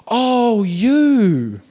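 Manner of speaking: hammed-up awkward recognition, long vowels; manager's recognition. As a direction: stretch it into a mock "oh, it's you". Acts manager seeing him return.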